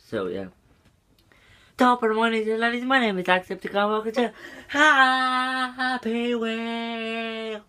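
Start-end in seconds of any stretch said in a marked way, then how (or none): 1.8-7.6 high-pitched excited YouTuber greeting; Jacksepticeye. Acted. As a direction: open high and fast, then hold a long held-out shout at the end. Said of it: "I do Jacksepticeye".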